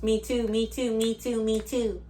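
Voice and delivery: mock parroting that ends in a noise of disgust; eager agreeing. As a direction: mimic a needy copycat on repeat. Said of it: "his ego was just".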